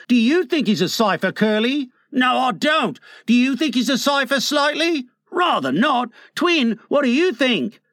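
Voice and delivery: put-on high-pitched boys in quick exchange; eager boys. A narrator's reading. Boys polling each other about Mr Darling, eager.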